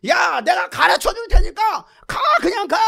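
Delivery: high shout with drawn-out words; exasperated God. Acted. God tells Moses just go, impatient shouting. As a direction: holler him out the door.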